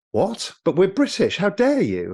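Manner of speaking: mock the entitled Brit, high and affronted; a hint of a huffy holidaymaker's "how dare you"; indignant Brits. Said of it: "And they're like".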